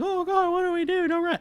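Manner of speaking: very high-pitched imitation of panicking people; mock panic. Teller mimics partygoers when the cops arrive, mock panic. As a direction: squeal it like a crowd freaking out. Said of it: "freaking out".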